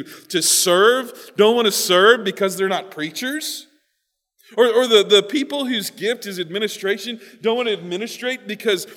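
high, loud, disbelieving challenge; incredulous challenge. Acted. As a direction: argue it as plainly absurd. Voices Paul's indignant incredulous challenge.

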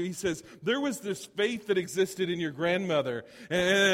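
high, loud pulpit exhortation; fired-up preaching. Acted. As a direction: preach it with surging conviction. Preacher retells Paul's charge to Timothy.

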